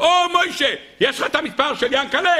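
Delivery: high-pitched street greeting; calling out. Acted. Man hailing Moishe on the street, calling out.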